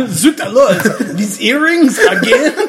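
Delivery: high, loud French inspector exclaiming; exasperated French inspector; French accent. Acted. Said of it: "what do you think the French inspector should react to".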